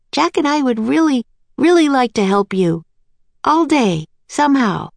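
put-on high-pitched pleading little girl; Annie, eager. A narrator's reading.

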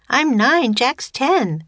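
put-on high-pitched little girl voice; young girl. A narrator's reading. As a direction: pipe up like an eager child. Narrator voices young Annie.

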